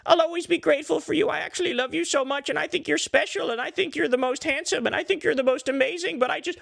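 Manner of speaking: high, groveling mock apology; simpering Tucker. Tucker grovelling to Trump in a high mock voice. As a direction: grovel to the boss in a high, pleading voice. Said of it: "And Tucker's like".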